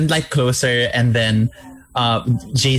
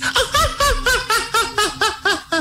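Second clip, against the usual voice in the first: imitating mom's laugh. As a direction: mimic a mom's big laugh. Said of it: "she laughs like this".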